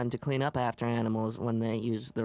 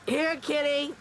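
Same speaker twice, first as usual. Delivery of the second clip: calling a lost pet; 13 semitones higher, slower than usual. Acted.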